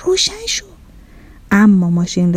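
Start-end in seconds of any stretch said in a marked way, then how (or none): 0.0-0.7 high, soft secret plea; furtive urging. A narrator's reading. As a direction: urge a friend on the sly, high and hushed. (یواشکی و ملتمسانه)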